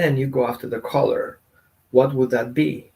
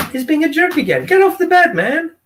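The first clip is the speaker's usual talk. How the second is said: much higher and louder, fed-up shouting; frustrated yell; 13 semitones higher, 4 dB louder than usual. Retold or imitated. Owner losing patience when the dog won't move, frustrated higher louder yell. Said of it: "And like".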